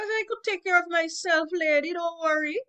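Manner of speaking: high-pitched little boy playing policeman; child policeman. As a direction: play a cocky kid in a policeman game, loud and high.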